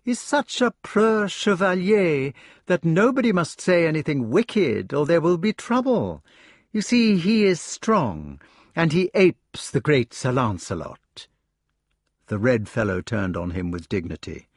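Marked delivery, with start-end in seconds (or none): 0.0-11.3 mock him to the room, not to his face; sneering mockery. Mordred taunting Gawain to the air, high and sneering.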